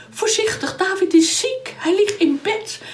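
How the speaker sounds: high, fussing mock concern; worried wife. Michal pretends David is ill, high and fussing. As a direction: act anxious to fool the soldiers.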